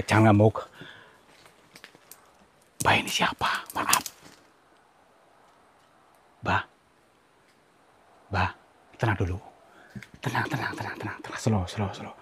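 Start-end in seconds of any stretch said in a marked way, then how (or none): 2.9-9.4 scared whisper to someone unseen. Real, not acted. Talking to an unseen spirit. 10.2-12.1 soft, fading calming words; soothing hush. Real, not acted. Calming an unseen spirit.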